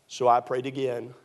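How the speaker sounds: ordinary talk, nothing marked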